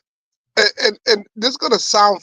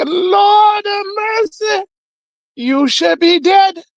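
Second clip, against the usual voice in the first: very high, drawn-out Jamaican wail; hammed-up shocked Jamaican nurse, high and slow; 13 semitones higher, 3 dB louder, slower than usual. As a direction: cry out aghast in a Jamaican accent. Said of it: "This Jamaican nurse coming here".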